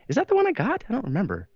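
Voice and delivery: sudden high startled doubt; baffled double take. Retold or imitated. Looking at the TV on Facebook, suddenly unsure and surprised. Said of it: "and I'm like".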